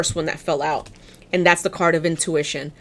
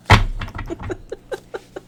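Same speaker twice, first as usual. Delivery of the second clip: high, breathless giggle; amused laugh; 12 semitones higher, 4 dB quieter than usual. Real, not acted. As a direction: burst into an airy, high laugh. Amused by her guides' message.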